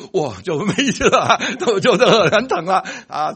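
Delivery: half-laughing exclamation about the pain. Real, not acted. Preacher laughing at the throbbing toe story, loud.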